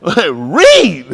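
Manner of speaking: loud, laughing one-word holler; comic yell; mock shout. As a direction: blurt it out big for the laugh. Joking outburst about all the reading.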